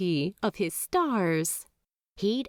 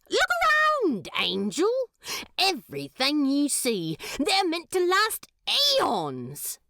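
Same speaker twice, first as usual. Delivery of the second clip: passionate pitch; 7 semitones higher than usual. A narrator's reading. Demon passionately defending stars.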